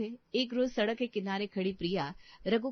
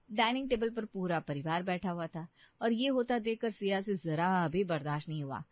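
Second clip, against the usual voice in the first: ordinary talk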